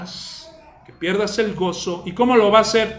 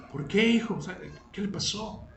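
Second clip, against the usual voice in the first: soft, much quieter fatherly question; concerned father; 9 dB quieter than usual. Acted. Father quietly asking his worried son what happened. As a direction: gently ask the boy what happened. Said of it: "father asks his son".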